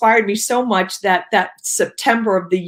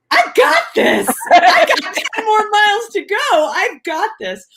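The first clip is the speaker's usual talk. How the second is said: high, loud, laughing yell of triumph; retold shout of triumph, pitched way up; triumphant cheer; 12 semitones higher, 4 dB louder than usual. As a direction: crow it out with a laugh. Retold triumphant cry at mile thirty.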